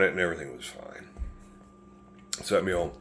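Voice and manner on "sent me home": ordinary delivery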